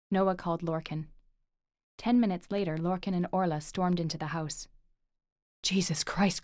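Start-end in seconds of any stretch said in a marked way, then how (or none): 5.6-6.4 breathless whispered gasp of shock. Acted. Uncle finding his collapsed brother, shocked whisper. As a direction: whisper it in horror.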